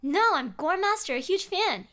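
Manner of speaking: put-on high, cheerful voice of a goth kid; proud teen fan.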